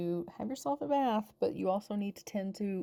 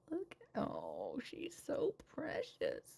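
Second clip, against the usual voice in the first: whisper; 5 semitones higher, 8 dB quieter, slower than usual. Real, not acted. Whispering over cute baby.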